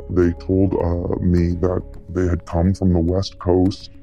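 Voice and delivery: usual speaking voice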